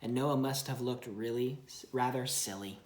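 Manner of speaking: storybook narration for a little one; reading to a child. Acted. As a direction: read it gently to your child. Parent reads Bible story aloud.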